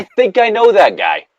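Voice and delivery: high, mock-squeaky repeat; a high cartoonish squeak; squeaky joke voice. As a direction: say it again in a high silly voice.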